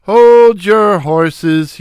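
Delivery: slow, loud drawn-out call; slow Shemp voice. A narrator's reading. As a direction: stretch it out like a stooge.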